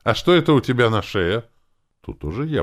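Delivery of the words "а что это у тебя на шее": loud, high, hostile question. A narrator's reading. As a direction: challenge with open dislike. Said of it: "неприязненно спросил второй брат Иры".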